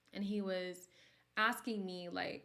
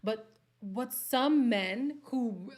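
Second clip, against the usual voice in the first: slow emphatic; 3 semitones higher, 10 dB louder, much slower than usual. Real, not acted. Drawn out emphasis.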